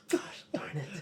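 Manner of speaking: soft whispered curse; whispered grumble. Acted. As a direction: grumble it under your breath. Player grumbles under their breath.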